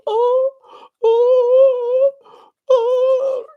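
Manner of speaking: very high howling oh oh oh; dog hollering. Retold or imitated. Imitating the dog Noodle hollering when left alone, a high howl. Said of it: "He'll just go".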